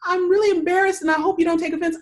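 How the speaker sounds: high, quick mock apology; retold embarrassment. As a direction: imitate an embarrassed guide apologizing hurriedly. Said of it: "She goes".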